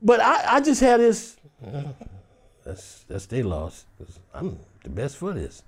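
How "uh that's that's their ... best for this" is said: quiet inner conviction; private self-talk. Acted. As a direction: murmur it to yourself. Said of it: "I don't say it to people".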